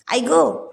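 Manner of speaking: a light startled "आई गो" with a shifted voice; mild mock yelp of pain. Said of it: "तशी ती ओरडली".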